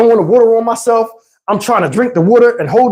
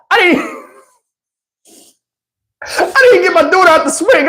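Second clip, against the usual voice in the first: usual speaking voice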